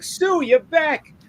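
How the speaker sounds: high, very slow, sing-song welcome back; fake cheerful greeting. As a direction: stretch it out with fake delight. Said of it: "he's like".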